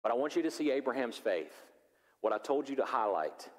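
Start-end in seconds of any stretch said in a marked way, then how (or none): none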